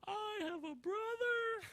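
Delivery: very high, stretched-out exclamation; overjoyed commercial guy. Retold or imitated. Man discovering his twin at the counter, hammed-up elation. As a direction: mimic amazed joy, high and slow. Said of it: "he's, he goes".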